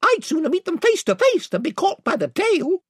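high-pitched defiant declaration; high, resolute storybook hero; story character's voice. Acted. Story character speaks before narration.

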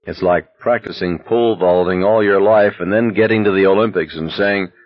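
ordinary delivery

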